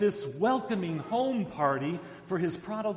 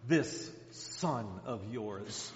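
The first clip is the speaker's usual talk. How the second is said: slow, low sneer at this; derisive; 8 semitones lower, 5 dB quieter, much slower than usual. Retold or imitated. Older son sneering at his father, slow and low. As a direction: spit out the words in contempt. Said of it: "derisive words of his older son".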